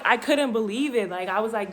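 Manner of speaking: ordinary delivery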